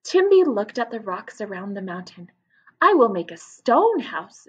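put-on lively read-aloud for children; story narration. A narrator's reading. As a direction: tell it to a class of young kids. Reads story aloud to class.

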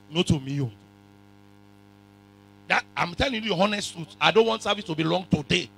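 normal talking voice

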